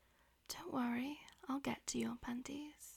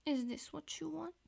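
normal talking voice

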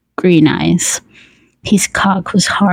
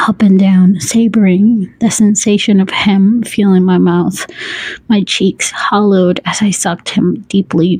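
plain reading voice

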